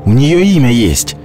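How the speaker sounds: high, mocking young lad; teasing boy voice. A narrator's reading. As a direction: tease her with a grin.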